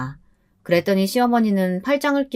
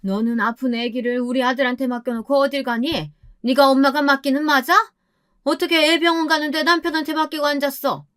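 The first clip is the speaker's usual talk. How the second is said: high-pitched mother-in-law telling off her daughter-in-law; accusing questions in a raised pitch; scolding mother-in-law; 6 semitones higher than usual. A narrator's reading. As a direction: put on a high, reproachful older-relative voice. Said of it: "시어머니가 저에게 말씀을 하시는".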